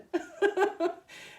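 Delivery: light high chuckle; happy laugh. Real, not acted. Laughs at dancing picture.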